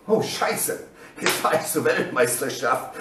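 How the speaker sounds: low, hushed disbelief; stunned realisation. Retold or imitated. Athlete realising he goes to worlds, low stunned murmur. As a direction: let it sink in under your breath. Athlete absorbing unexpected qualification.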